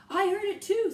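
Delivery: loud, high eager cry; eager sailor. A narrator's reading. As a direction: chime in loudly. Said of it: "said another".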